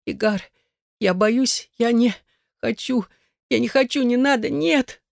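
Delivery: high, frightened pleading; losing voice control. A narrator's reading. Terrified woman pleads with Igar, high failing voice. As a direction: plead in rising panic, pitch climbing. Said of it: "Голос Элаза наконец-то перестал ей повиноваться".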